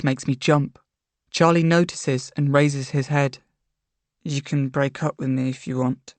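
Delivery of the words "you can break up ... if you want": quiet resignation. A narrator's reading. Charlie defeated, head lowered.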